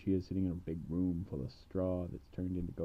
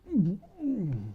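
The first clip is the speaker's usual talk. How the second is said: smug agreement; 12 semitones higher, 7 dB louder than usual. Retold or imitated. King smugly confirms.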